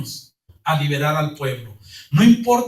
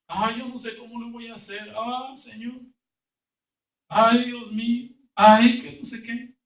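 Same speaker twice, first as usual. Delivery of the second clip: mock lament; 4 semitones higher than usual. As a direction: moan like someone sure they'll sink. Voicing a doubting believer's lament.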